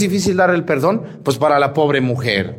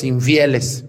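ordinary talk

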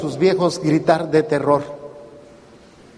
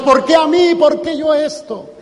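mock outcry to God; 10 semitones higher than usual. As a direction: cry out to God in protest. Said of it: "llegamos a gritarle a Dios". (reclamo a Dios, agudo y fuerte)

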